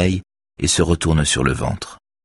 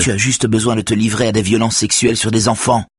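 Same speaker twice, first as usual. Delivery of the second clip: desperate; 6 semitones higher than usual. A narrator's reading. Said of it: "semble complètement désespéré".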